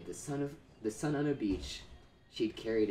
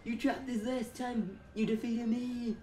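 put-on Mexican accent; 13 semitones higher than usual. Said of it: "can you do a Mexican accent?".

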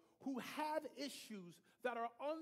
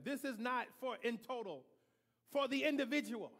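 louder, driving sermon delivery; preaching at full force; 5 dB louder than usual. Real, not acted. Preacher to congregation, rising forceful preaching. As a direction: press the point on the congregation.

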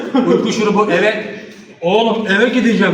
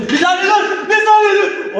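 panicked yell to stop; shouting; 12 semitones higher, slower than usual. Real, not acted. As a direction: yell stop as ice hits. Ice poured on him, shouting.

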